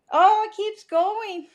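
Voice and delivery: high, slow whine at the lingering burn; pained whine. Real, not acted. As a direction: drag it out, high and suffering. Burning whisky finish won't stop.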